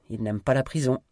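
ordinary delivery, nothing marked

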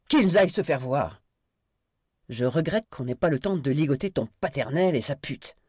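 loud, high-pitched scornful outburst; angry contempt. Acted. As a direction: lash out dismissively. Angry dismissal of hostages.

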